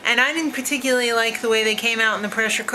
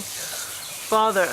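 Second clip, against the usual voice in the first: deep posh voice; 2 semitones lower, 4 dB quieter than usual. Pretend. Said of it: "He's more like".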